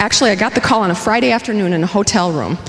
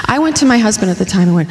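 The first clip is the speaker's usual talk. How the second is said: ordinary delivery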